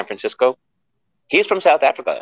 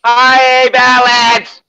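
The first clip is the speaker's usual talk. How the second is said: much higher, louder squealed hi; excited greeting; 13 semitones higher, 9 dB louder, much slower than usual. Real, not acted. Welcoming Balance into the chat, delighted squeal.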